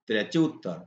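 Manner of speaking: normal talking voice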